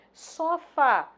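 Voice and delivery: "Sofá" high and loud, stressed on "fá"; stress on last syllable. Shown as a demonstration. Teacher naming an oxytone, "Sofá" with stressed "fá". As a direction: punch the "fá" in "Sofá". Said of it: "sílaba tônica fá".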